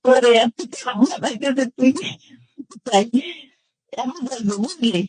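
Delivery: ordinary talk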